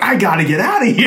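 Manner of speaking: high, loud mock panic through laughter; panicked Stan Lee. Stan Lee fleeing the rival studio's movie, mock panic. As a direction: act out a comic hasty exit, laughing. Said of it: "And he's like".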